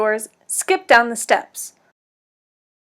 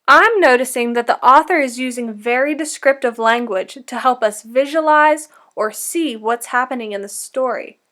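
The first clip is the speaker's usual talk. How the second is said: ordinary delivery, nothing marked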